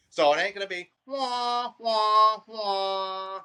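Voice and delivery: sad trombone imitation. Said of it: "similar to one of your little sounds".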